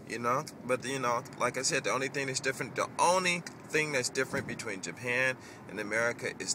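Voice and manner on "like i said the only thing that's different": usual speaking voice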